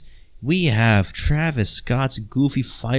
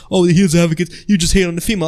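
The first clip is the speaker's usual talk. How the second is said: mocking commenter voice; 5 semitones higher, much faster than usual. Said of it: "stupid comments right now in the comment section saying".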